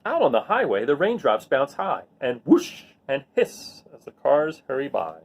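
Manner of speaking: usual speaking voice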